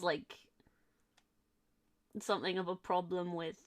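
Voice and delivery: ordinary talk, nothing marked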